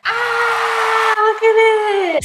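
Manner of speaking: high, loud, slow delighted squeal; excited reveal. Real, not acted. Big reveal after countdown.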